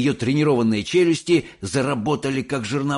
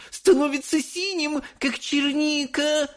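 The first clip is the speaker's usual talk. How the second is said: very high, horrified wail; high drawn-out alarm; straining scream. A narrator's reading. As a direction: keep shrieking as a panicked mother. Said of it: "надрывалась миссис Боригард".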